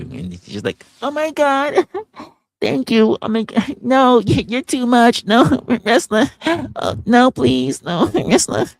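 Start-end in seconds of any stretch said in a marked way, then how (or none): 1.0-8.7 high, loud giggly put-on woman's voice; flattered woman, imitated. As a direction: play her bashfully protesting between giggles, pitched way up. Flattered woman in imagined dialogue.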